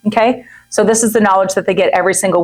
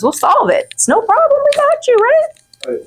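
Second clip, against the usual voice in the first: excited reassurance. Real, not acted. Reassuring coworker, soaring pitch.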